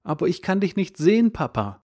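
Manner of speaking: mild acted tears, crying down from the window; tearful. Son calling down to his father, mildly tearful. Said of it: "Hustend und mit Tränen in den Augen".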